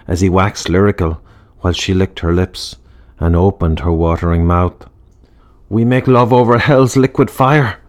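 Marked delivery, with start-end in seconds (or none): 5.7-7.8 cried out. A narrator's reading. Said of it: "cried Pat".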